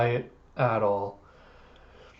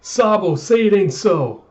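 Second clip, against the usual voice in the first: crying out in grief; 6 semitones higher, 5 dB louder than usual. Acted. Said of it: "Dadan and family just crying".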